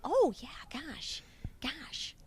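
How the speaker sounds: high breathy Midwest gosh; broad Midwest accent. Shown as a demonstration. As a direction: mouth held broad, Cincinnati style. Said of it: "hold your mouth a little bit broad like this".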